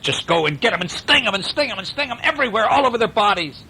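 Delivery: high, fast witch voice; frantic witch. Acted. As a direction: rattle off the orders like a witch. Witch orders the bees.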